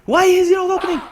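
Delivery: loud, high-pitched outburst of impatience; exasperated shout. Real, not acted. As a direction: yell in real exasperation at the holdup. Door will not open, exasperated.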